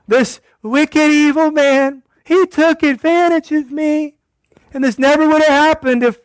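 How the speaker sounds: high, loud, shrill-sounding mock accusation; blaming wife. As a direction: whine the blame at the husband. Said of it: "She's blaming her husband".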